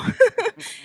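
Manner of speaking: high bright giggle. Real, not acted. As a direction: laugh at the memory. Bride laughs recalling Bumble.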